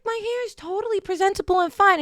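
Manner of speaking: high-pitched mock protest; indignant protest. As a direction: plead your case up high. Daughter protesting in retold argument.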